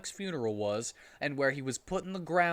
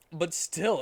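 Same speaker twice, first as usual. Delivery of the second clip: ordinary delivery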